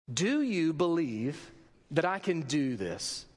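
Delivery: slow, weighty question with a pause after believe; slow, solemn question. Retold or imitated. Jesus questioning two blind men, slow solemn question. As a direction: ask it gravely, word by word.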